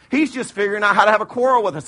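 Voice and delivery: ordinary talk, nothing marked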